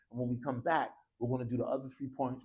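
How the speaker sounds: ordinary talk, nothing marked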